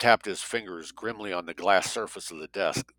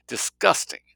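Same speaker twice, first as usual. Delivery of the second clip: higher, louder cry of disgust; revolted; 10 semitones higher, 5 dB louder than usual. A narrator's reading. Greta pleading with Jake to refuse, disgusted. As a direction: hit it hard in revulsion. Greta protesting, repeats in revulsion.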